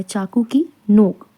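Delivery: whispered. Shown as a demonstration. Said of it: "teacher repeats phrase for class".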